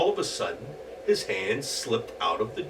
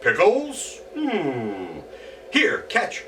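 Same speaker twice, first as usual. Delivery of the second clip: a greedy little goblin eager for pickles; 9 semitones higher, much slower than usual. Acted. Goblin answers Herschel.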